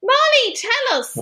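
high, bright call to the cat. Real, not acted. Calling out to the cat.

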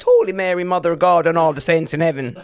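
mock Irish accent. Shown as a demonstration. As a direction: exclaim it as a stock Irish oath. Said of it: "can say in an Irish accent".

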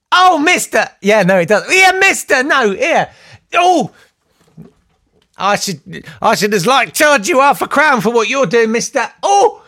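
high, loud Cockney streetwalker cries; Victorian lady of the night. Pretend. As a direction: squeal at him like a Jack the Ripper-era streetwalker. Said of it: "some weird character as a sort of Lady of the Night".

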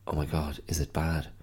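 ordinary talk, nothing marked

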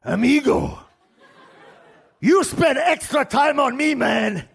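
high, loud, slow gushing thanks; overflowing with gratitude; grateful inmate. Retold or imitated. Inmate thanking the barber for the haircut, high gushing thanks. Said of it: "He goes".

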